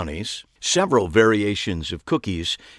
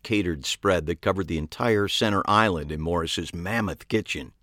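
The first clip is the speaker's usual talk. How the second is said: ordinary delivery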